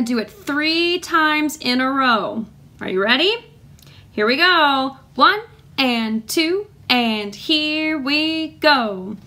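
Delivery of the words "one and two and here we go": slow, measured count-in on the beat. Shown as a demonstration. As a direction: stretch each word to set the tempo. Teacher counts the class in.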